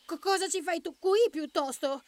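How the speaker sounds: high, stammering little rabbit; nervous high comeback; startled rabbit. A narrator's reading. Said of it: "rispose Pepe".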